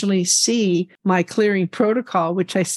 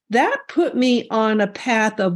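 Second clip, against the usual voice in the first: ordinary delivery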